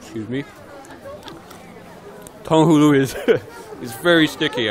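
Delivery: words round a mouthful; mouth full. Real, not acted. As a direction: still chewing sticky candy. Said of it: "I've still got it in my mouth".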